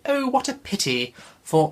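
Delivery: Harriet as a very high, posh royal lady; the Queen. Acted. Vlogger reads Harriet to viewers as a high royal lady. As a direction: read it like the Queen herself. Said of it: "why does she sound like the Queen?".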